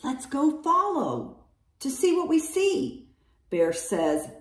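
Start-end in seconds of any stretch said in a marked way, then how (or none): 0.0-3.1 high, sing-song suggestion; hare speaking. A narrator's reading. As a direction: pitch it up for a small hare. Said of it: "Hare says".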